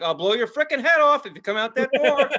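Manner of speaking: guard mock-threatening friends at the door; mock threat.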